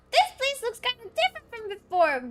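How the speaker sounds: very high, squeaky game companion; squeaky character voice. Acted. Game companion noticing a changed place, squeaky character voice. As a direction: push it right up into a little mascot voice.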